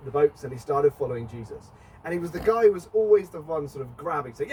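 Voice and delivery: ordinary talk, nothing marked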